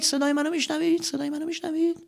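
fading cave echo. Acted. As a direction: let the question echo away.

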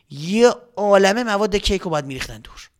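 ordinary delivery, nothing marked

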